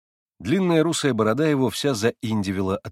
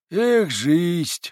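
a sighing lament, raised in pitch; heartfelt sigh; 6 semitones higher, 4 dB louder than usual. Acted. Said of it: "вздохнул мужик от всего сердца".

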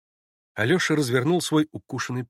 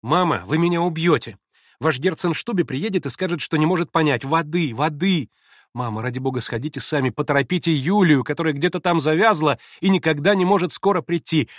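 капризная паника; 5 semitones higher, 6 dB louder than usual. Acted. Лиз капризно торопит мать.

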